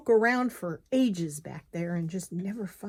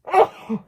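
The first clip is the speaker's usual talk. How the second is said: sneezing; 13 semitones higher, 15 dB louder than usual. Real, not acted. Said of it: "oh, excuse me".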